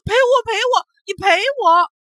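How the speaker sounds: high, loud wailing demand; crying shout. Acted. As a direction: wail it out, crying. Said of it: "哇的一声哭起来，嘴里喊着".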